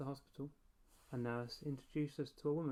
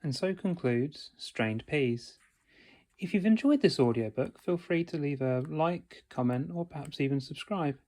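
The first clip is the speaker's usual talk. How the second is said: audiobook outro; 11 dB louder than usual. Acted. Narrator closes the audiobook for listeners, loud announcer style. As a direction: sign off like a channel host.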